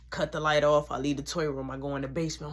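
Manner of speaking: usual speaking voice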